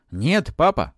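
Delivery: high, loud refusal; yelling. A narrator's reading. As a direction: cry out to stop him. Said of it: "заорал Матвей".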